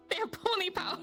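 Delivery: high, squeaky giggly voice; laughing. Real, not acted. Player cracking herself up over pony power, laughing. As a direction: squeeze the punchline out through laughter.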